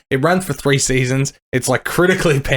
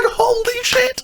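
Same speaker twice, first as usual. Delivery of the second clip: very high squealed amazement; thrilled amazement; much slower than usual. Retold or imitated. Fan reacts to the unexpected season, high thrilled squeal.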